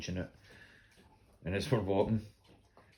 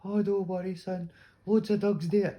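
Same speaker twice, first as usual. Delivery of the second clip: put-on high, louder kindly old lady voice; old woman reassuring; 13 semitones higher, 7 dB louder than usual. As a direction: brush it off like a cheerful granny. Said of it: "she's like".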